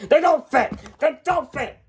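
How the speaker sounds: high, loud, angry complaint; fuming, high-pitched protest; mad outburst. Acted. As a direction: shout it in a high voice. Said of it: "why are you getting so mad".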